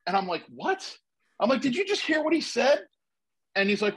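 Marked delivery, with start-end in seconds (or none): 0.5-2.8 high, incredulous cry of disbelief; a retold high-pitched what; stunned disbelief. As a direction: act like you can't believe your ears. Retelling his stunned reaction.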